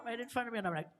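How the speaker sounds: ordinary talk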